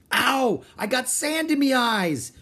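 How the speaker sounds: high, loud pirate whine; whining. A narrator's reading. Pirate with sand in his eyes, whining. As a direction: wail it in pained complaint. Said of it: "Pinky whined".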